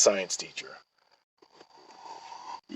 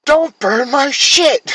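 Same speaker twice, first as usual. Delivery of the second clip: high, loud, slow imitated yell; hammed-up angry whine; mock high yell; 8 dB louder, much slower than usual. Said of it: "sister yelling in the story".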